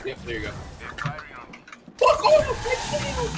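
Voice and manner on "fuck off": high, loud angry yell; frustrated shout. Real, not acted. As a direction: yell it at the enemy. Enemy attacks, shouts in frustration.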